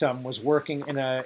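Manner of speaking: ordinary talk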